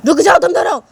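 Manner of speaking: loud, firm stop; firm command. Acted. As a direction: order them to stop. Mother stops them. (ऊँचा, सख़्त आदेश)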